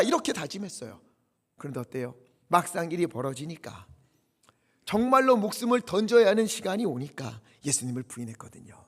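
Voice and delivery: ordinary talk, nothing marked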